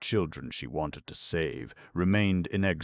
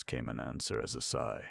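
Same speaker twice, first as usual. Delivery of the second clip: plain reading voice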